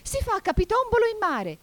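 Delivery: very high, loud, excited cry; madcap shout. A narrator's reading. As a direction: shout it as if leaping about madly. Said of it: "gridò la falsa testuggine saltellando pazzamente".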